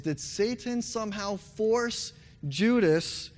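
ordinary talk

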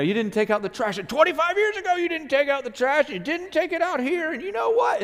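high, loud, building accusatory rant; nagging historical fight. Retold or imitated. A spouse listing old wrongs, escalating accusation. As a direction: pile up every old grievance.

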